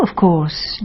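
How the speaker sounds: ordinary talk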